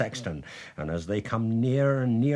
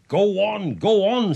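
loud, higher-pitched prodding; insistent urging; 8 semitones higher, 7 dB louder than usual. A narrator's reading. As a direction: push him impatiently to get on with it. Wife urges him on again.

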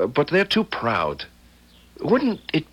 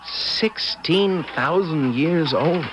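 slow, drawn-out amazement, pitched up; astonished; 5 semitones higher, much slower than usual. Acted. Boy hears the angel is sixteen thousand years old, astonished. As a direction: stretch the words out in disbelief.

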